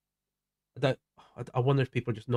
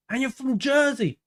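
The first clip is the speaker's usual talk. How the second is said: 1 surprised; 10 semitones higher, slower than usual. Real, not acted. Surprised reply to a chatter.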